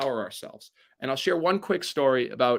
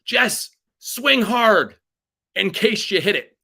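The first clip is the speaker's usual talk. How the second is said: loud, high, slow yell from the stands; drawn-out shouted encouragement; dad yelling; 9 semitones higher, 8 dB louder, much slower than usual. Retold or imitated. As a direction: call it out to the batter from the crowd. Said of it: "my dad yelled".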